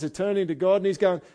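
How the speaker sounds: usual speaking voice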